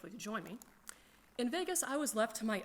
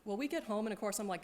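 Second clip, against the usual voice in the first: ordinary talk, nothing marked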